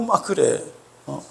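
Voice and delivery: normal talking voice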